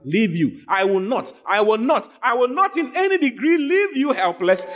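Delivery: rising, emphatic preaching; fervent sermon build. Acted. Preacher proclaims the repeated negatives.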